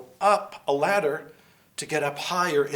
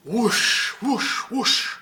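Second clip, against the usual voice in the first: whoosh sound; 7 semitones higher, much slower than usual. Acted. Sliding down the pole.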